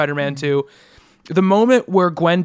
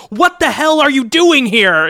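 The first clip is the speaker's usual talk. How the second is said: higher, louder, slow mock Peter; imitating Peter; 7 semitones higher, 4 dB louder, slower than usual. Imitating Peter on the bridge, baffled outburst. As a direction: ham up his baffled outburst. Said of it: "he's just like".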